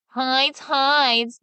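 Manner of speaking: jeering sing-song echo of his cry; the townsfolk mock Big Claus. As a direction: taunt him with his own call. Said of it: "they mocked him".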